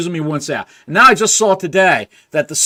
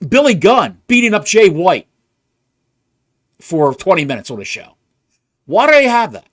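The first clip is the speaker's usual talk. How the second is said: louder and higher, hammering each "why"; exasperated rant; 6 semitones higher, 4 dB louder than usual. Real, not acted. Podcaster venting to his co-host about the show, exasperated and loud. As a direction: throw your hands up at every booking choice. Ranting about the show's booking.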